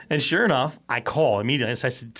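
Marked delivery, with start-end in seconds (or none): none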